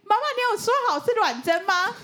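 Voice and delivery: put-on high, childlike pleading question; lightly voiced anxious child. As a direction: sound like a worried little kid. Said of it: "然后我就说".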